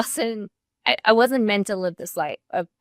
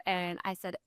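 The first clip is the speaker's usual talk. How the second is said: ordinary talk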